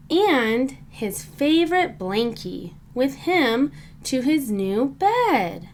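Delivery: ordinary delivery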